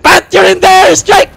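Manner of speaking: yelling a warning; urgent shout. Real, not acted. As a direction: shout it across the field. Warning teammate in airstrike.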